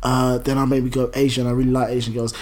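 low, soft, hesitant reply; hushed, halting mock confession; imitated undertone. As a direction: mimic someone keeping his voice down. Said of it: "he was proper whispering".

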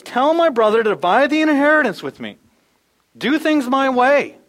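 high, loud demand; demanding command. Acted. A man in the crowd ordering Jesus to settle his inheritance. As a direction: order it, don't ask it. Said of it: "he commands Jesus".

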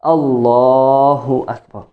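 "Allahu" with the lam held long, slow; elongated lams; pulled lam. Shown as a demonstration. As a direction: lean on the double l of "Allahu". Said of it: "some ulama say it's still okay is to pull the".

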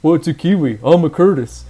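lightly voiced, dry and flat; deadpan. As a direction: deliver it bone-dry.

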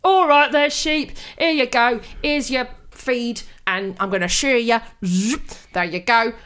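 lightly voiced Cockney farmer talking to sheep; a hint of a Cockney farmer feeding his flock. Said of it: "Cockney farmer".

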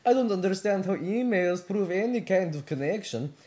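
put-on Russian accent. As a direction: argue the defence in a Russian accent.

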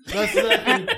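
ordinary delivery

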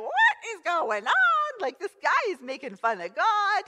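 high, loud disbelief with a laugh; incredulous, half laughing at the outrage; young David scoffing in shock; indignant David. Retold or imitated. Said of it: "David's like".

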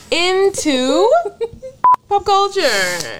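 giggling into the topic; laughing. Real, not acted. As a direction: laugh through the segue. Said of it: "All right".

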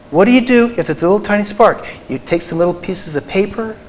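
ordinary talk, nothing marked

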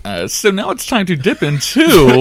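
high-pitched mummy groan through the words. Acted. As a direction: wail it like a cartoon mummy, swelling at the end. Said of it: "mummy voice is new best friend".

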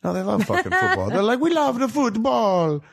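mock Italian accent, pitched high; hammed-up Italian fan loving football. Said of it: "Excellent Italian accent".

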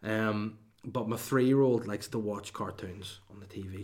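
usual speaking voice

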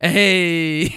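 drawn-out celebratory hey; mock cheer. Two onlookers cheer as the door shuts, retold cheer. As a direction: cheer him on with a long hey. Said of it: "Me and his wife cheer for him".

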